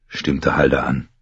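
usual speaking voice

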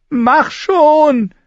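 high, loud bark of command; roaring. Acted. Mira roars at her brother to climb, high and loud. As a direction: roar at him to hurry. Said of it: "brüllte sie".

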